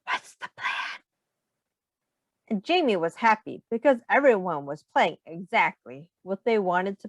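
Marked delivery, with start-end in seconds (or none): 0.1-1.0 soft unvoiced conspiratorial whisper. A narrator's reading. Jamie crouching by friends, whispering the plan. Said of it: "Jamie crouched down next to them and whispered".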